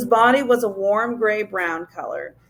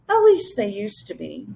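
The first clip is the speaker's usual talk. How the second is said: ordinary delivery, nothing marked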